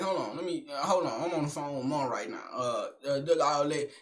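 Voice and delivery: voicing the girlfriend in a low hushed brush-off. Girlfriend on the phone brushing the uncle off, low and hushed.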